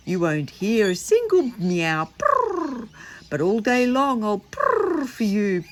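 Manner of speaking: high, loud purring cat voice; a storyteller purring the song for the children. Shown as a demonstration. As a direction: sing it like a contented cat. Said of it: "I did it in a purr voice".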